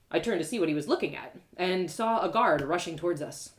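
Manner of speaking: ordinary delivery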